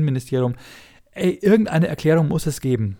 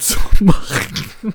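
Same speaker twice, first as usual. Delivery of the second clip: laughing; 6 semitones higher, 7 dB louder, much slower than usual. Real, not acted. Jokes about housework, laughs.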